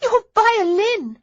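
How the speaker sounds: high, loud dismayed cry; alarmed young lady. A narrator's reading. Daisy alarmed at broken violin.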